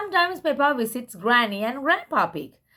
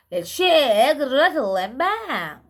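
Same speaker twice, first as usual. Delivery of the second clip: booming Daddy Pig; 2 semitones higher than usual. Acted. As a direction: boom it out like a jolly dad. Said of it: "He has a big booming voice".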